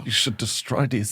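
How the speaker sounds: lightly imitated solemn German; grave accented pronouncement; Werner Herzog. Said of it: "I love Werner Herzog in that".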